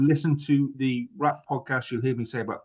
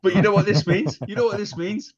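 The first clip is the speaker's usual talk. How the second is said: excited; 10 semitones higher, 4 dB louder than usual. Real, not acted. Eager announcement.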